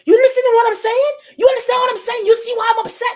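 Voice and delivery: high, loud, insistent demand; hammed-up agitated shouting; acted upset. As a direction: demand to be heard while venting. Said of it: "sometimes I'm like".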